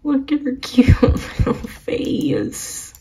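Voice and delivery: high, whispery squeal broken by laughter; going insane. Real, not acted. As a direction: coo breathlessly over something adorable. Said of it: "I'm, like, literally going insane".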